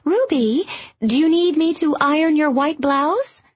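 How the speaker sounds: mother calling up to her daughter; a voice carried from another floor; calling from downstairs. A narrator's reading. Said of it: "she heard her mother call".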